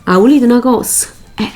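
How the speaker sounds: ordinary delivery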